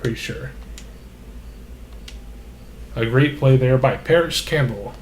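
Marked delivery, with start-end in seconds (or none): none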